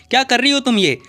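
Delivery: sharp, high, loud scolding of her sister; angry little sister scolding. Acted. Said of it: "बहुत ज़्यादा गुस्सा कर रही होती है".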